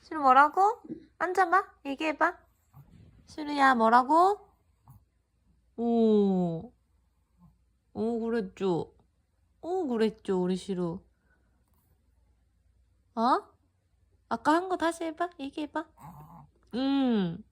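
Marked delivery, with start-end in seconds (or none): 0.0-4.5 high coaxing pet voice; sweet-talking a dog. Real, not acted. Owner coaxing the dog to speak. 14.3-15.8 talking to a dog. Real, not acted. Owner coaxing the dog to speak.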